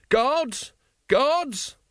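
high-pitched cry for help from henchmen; shrieked summons; calling out. Acted. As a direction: summon them urgently at the top of your range. Wonse calls out for guards.

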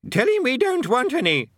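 put-on very high, loud old man; old wizard. A narrator's reading. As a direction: bark it like a cranky old wizard. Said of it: "said the old wizard".

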